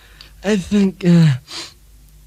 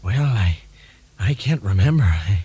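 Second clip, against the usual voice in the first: Ronald Reagan impression; 8 semitones lower than usual. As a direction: play a drowsy, forgetful Reagan. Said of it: "I'm only fucking Reagan".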